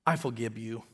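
Landon. Retold or imitated. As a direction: say it like a two-year-old, blurring the V. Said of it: "And Landon said".

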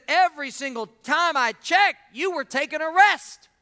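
loud, high-pitched indignant complaint; mad, accusing. Acted. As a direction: act out a sore loser's heated accusation. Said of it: "And he was so mad".

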